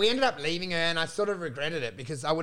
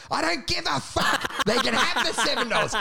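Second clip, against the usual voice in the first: exasperated, pitched-up protest; retold yelling; 6 semitones higher than usual. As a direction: act out a ranting phone customer.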